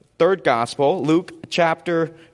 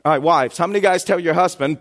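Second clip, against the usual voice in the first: ordinary talk, nothing marked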